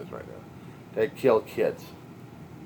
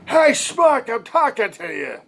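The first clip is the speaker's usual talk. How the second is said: much higher, louder New York wise-guy God; a brash New York accent, calling him a schmuck; New York accent, pushy and impatient; 13 semitones higher, 7 dB louder, slower than usual. Retold or imitated. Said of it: "Started talking to him in a New York accent".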